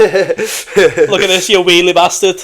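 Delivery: high, loud laughing jeer; playful shouted taunt; mock heckle. Acted heckle at stair show-off.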